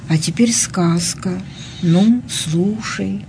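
slow soft whisper. Acted. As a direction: hush it like a bedtime secret. Grandma begins bedtime tale.

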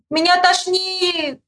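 high, slow wail; high drawn-out cry; shouted complaint. A narrator's reading. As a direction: call it out, dragging the last word. Rider shouts back feeling sick.

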